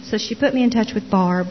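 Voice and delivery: ordinary delivery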